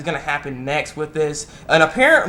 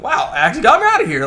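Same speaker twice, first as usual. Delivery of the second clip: high-pitched amazed exclamation; retold wide-eyed "wow" reaction; 10 semitones higher, 4 dB louder than usual. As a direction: act out surprised relief. Acted reaction to falling through.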